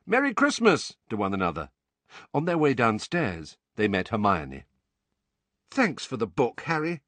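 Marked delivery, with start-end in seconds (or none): 0.1-1.0 high, cheery holiday call. A narrator's reading. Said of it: "various inhabitants of the house calling". 5.7-7.0 lightly lifted, pleased thanks; a touch of happy gratitude; happily. A narrator's reading. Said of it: "she said happily".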